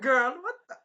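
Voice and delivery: very high, loud squawk; mock shock. Character reacting to the girl, high mock shock. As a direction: squawk it in disbelief.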